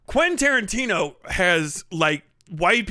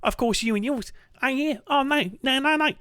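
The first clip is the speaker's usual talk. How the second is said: put-on South African accent; 3 semitones higher than usual. Tarantino playing the slave trader, bad South African accent. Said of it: "he's talking about South Africa, so he's like".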